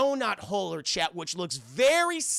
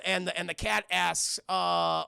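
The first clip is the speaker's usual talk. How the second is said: normal talking voice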